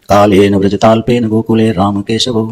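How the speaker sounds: verse recitation. Acted. Quoting a Sanskrit verse.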